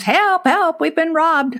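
high-pitched mock yell for help, retelling it; replaying a frantic cry for help, pitched well up; retold scream for help. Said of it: "screaming at the top of my lungs".

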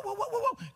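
playful imitated dog barks; barking dog. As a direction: bark like a little dog in fun.

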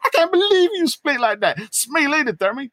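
high mock scolding. Hope telling Scotty off, high mock scold. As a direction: scold him in a hammed-up voice. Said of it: "she said".

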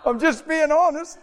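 high-pitched, laughing admission; amused protest. Real, not acted. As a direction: squeal it out through amusement. Laughing admission about himself.

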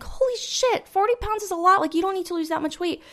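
high, shocked friend voice. Retold or imitated. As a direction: react like a stunned friend. Quotes friend's shocked reply.

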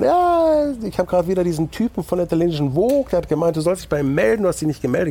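high-pitched, excited imitation of a friend calling; aufgeregter Kumpel. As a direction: play the buddy bursting with news, pitched well up. Acted phone call from a buddy. (aufgeregt nachgemachter Kumpel, hohe Stimme)

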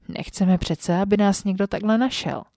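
usual speaking voice

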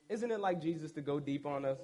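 usual speaking voice

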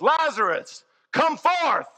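a ringing call to come out; commanding call. Acted. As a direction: summon the dead man out with authority. Jesus calling Lazarus from tomb.

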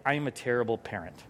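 low, soft self-blame; mock dejection. Preacher voices a parent's bad day, low and dejected. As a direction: sag into a quick parental confession. Voicing a parent's guilty thought.

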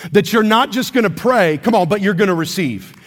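normal talking voice

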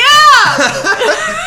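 high, loud yell then laughter; excited shout. Real, not acted. Recalling the documentary with friend, excited shout and laugh. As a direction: shriek with delighted recognition.

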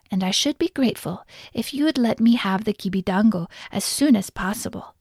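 ordinary delivery

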